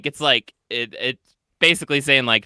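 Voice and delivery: ordinary talk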